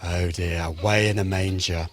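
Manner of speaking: low, soft mock groan; groaning at a pun. Real, not acted. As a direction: sigh at the terrible pun. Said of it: "that's a very bad one".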